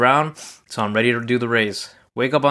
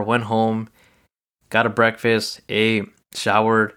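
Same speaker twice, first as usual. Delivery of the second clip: ordinary talk, nothing marked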